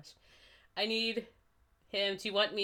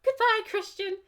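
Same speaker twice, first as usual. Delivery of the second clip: loud, high goodbye; hammed-up, high-pitched farewell; high-voiced farewell; 14 semitones higher, 7 dB louder than usual. A narrator's reading. Acted farewell, first take.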